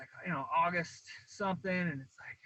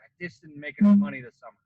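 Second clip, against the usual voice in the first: suddenly louder, exasperated; loud complaint; 11 dB louder than usual. Retold or imitated. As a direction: blurt it out, fed up. Retelling his own complaint.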